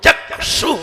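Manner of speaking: चक्षु said firmly with weight; stressed चक्षु. Shown as a demonstration. Teacher insists students stress चक्षु. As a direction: press hard on चक्षु. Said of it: "जोर देना है".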